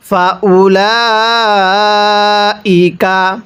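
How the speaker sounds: extremely slow chant ending on long নার; tajweed recitation; Quran recitation. Shown as a demonstration. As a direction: draw every syllable out. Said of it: "কিভাবে পড়ব?".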